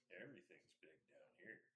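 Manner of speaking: hushed low goblin mutter. Acted. Nervous goblins on watch.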